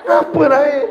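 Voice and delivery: squeaky high, loud fretting mother. Retold or imitated. Mother presses her child, squeaky high question. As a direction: ask high like a worried mum.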